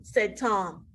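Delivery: usual speaking voice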